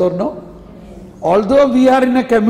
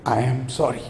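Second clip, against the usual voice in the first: low, soft apology after a sigh; sigh, then apologize quietly; regretful apology; 9 semitones lower, 8 dB quieter than usual. Retold or imitated. Abraham's soft apology after pleas.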